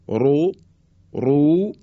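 "رو" with a thick R and u vowel; heavy R. Shown as a demonstration. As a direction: say "رو" full and heavy, twice. («رو» با رای درشت و مضموم)

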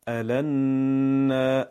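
الن drawn out slowly with a heavy n; doubled n demo. Shown as a demonstration. Teacher joins drill syllables, slow and firm. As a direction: hold and press the doubled n in الن. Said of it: "syllable drill ending".

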